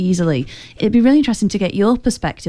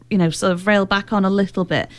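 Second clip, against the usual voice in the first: ordinary talk, nothing marked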